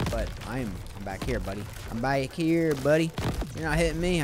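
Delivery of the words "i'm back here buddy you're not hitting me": put-on louder, higher southern accent taunt; playful southern accent. Player taunting the attacking enemy, in a southern accent. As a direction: throw on a bit of Southern twang. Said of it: "went with a southern accent on that".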